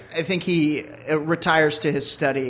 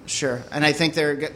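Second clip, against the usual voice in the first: ordinary talk, nothing marked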